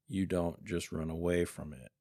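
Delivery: ordinary talk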